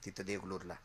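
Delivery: ordinary delivery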